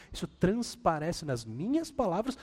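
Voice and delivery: ordinary talk, nothing marked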